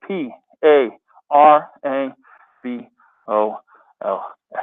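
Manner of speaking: letter by letter, P A R A B O L A; spelling it out. Shown as a demonstration. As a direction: spell each letter slowly and clearly. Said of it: "let's spell it right".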